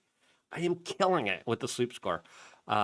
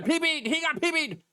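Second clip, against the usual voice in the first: much higher squeaky mock of a news anchor; mocking CNN. Host mocking CNN anchors, very high and ridiculing. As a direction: overdo it, shrill and silly. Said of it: "C N N".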